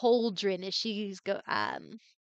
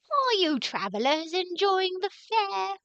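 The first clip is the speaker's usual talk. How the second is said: witch voice; 8 semitones higher than usual. Acted. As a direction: ask it as a theatrical witch, pitched high. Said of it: "as she finishes her cackles".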